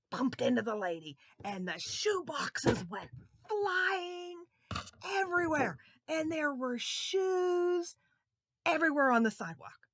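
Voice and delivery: high and loud, building drama; excited narration. A narrator's reading. Storyteller to children, shoes flying, excited. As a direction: let the crash burst out.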